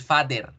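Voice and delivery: "father" as FAH-der, sounded r; Spanish-style pronunciation. Shown as a demonstration. Teacher shows what they will not repeat, Spanish-style pronunciation. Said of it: "no vamos a volver a decir".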